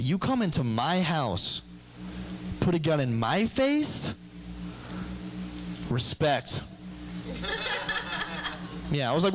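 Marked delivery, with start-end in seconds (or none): 0.0-6.6 gangster's respect. Retold or imitated. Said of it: "He said". 6.6-8.9 real giddy laugh; high laugh. Real, not acted. Laughing at his own story.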